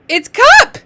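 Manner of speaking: high, loud, delighted discovery; excited reveal. Shown as a demonstration. Teacher revealing the word to a reader, loud and excited. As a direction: burst out with the answer. Announces the word just read.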